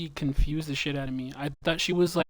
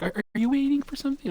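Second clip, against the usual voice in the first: nervous stranger; 12 semitones higher than usual. Retold or imitated. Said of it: "They're like".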